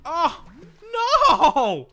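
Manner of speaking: high, loud drawn-out no; anguished yell. Real, not acted. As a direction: cry out as you get killed. Dying to the boss.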